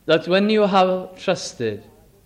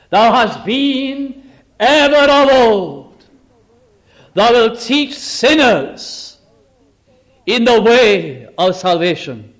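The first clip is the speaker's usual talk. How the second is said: full force preaching; 3 semitones higher, 5 dB louder than usual. Acted. Preacher thundering the psalm's promises, loud and slow. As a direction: ring out each line to the congregation.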